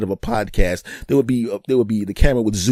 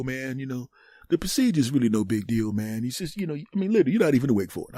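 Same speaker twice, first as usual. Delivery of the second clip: higher-pitched casual buddy voice; reassuring older friend; 6 semitones higher than usual. Acted. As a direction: reassure him as one who's been through it. Plays a friend who had one.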